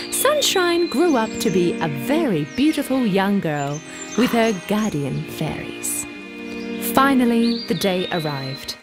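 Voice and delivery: usual speaking voice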